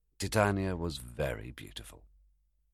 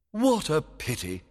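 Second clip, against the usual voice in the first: fairy king voice; 11 semitones higher, 4 dB louder than usual. Acted. Oberon thinking.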